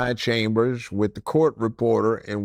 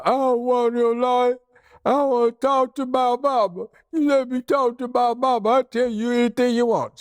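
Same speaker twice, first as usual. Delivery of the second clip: high, wailing sobbing plea; mock crying tough guy, pitched way up; crying for mama; 10 semitones higher than usual. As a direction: blubber like a scared kid begging for his mama. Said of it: "And he's crying".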